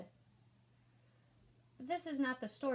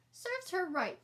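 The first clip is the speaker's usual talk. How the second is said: put-on much higher, louder child voice; smug little boy; 9 semitones higher, 5 dB louder, slower than usual. A narrator's reading. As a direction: gloat to yourself. Ian's smug thought.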